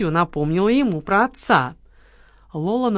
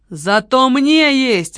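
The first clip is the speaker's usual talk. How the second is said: very slow, loud, higher outcry; shouted; 5 semitones higher, 6 dB louder, much slower than usual. A narrator's reading. Marquis shouts at Kamila to defend Lola, loud. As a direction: protective defiance. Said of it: "выкрикнул Маркиз".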